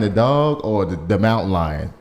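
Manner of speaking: ordinary delivery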